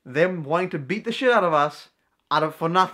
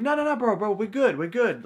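nervous placating; 6 semitones higher than usual. Retold or imitated.

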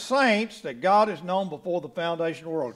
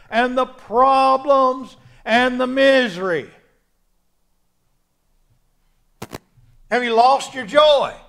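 loud, ringing sermon delivery; full-force preaching; 7 semitones higher, 9 dB louder than usual. Real, not acted. Preacher urging believers toward their joy, full-force preaching. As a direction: drive each phrase home to the congregation, loudly.

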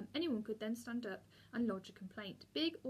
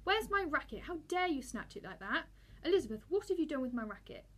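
higher-pitched, indignant outburst; fuming demand; 5 semitones higher, 5 dB louder than usual. A narrator's reading. Boy confronting Elizabeth over his snatched racket, fuming. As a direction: fire off indignant questions, pitch jumping up.

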